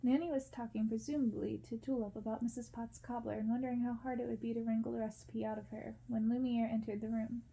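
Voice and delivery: ordinary delivery, nothing marked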